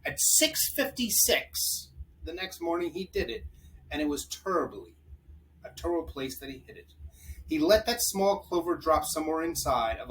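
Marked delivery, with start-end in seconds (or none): none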